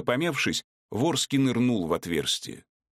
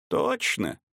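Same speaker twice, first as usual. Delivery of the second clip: sly high taunt; 13 semitones higher than usual. Acted. Druid mocking question.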